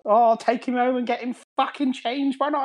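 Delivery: high-pitched mock Cockney mother; hammed-up high mum voice. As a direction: squawk back like a put-upon Cockney mum. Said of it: "she sounds like Cockney a little bit".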